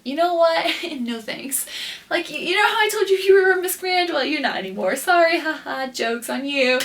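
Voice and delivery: hammed-up imagined brush-off; mock rejection. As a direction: imitate someone gleefully taking back good news. Imagined rejection message from Alex, mocking.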